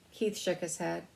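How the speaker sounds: usual speaking voice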